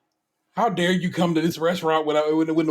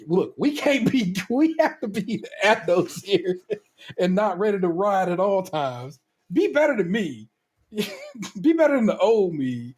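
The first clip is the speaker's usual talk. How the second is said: laughing advice; 4 semitones higher than usual. Retold or imitated. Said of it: "I'm like".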